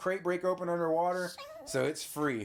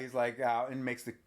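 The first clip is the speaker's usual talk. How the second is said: ordinary talk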